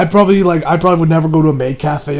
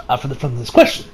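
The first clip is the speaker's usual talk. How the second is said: ordinary delivery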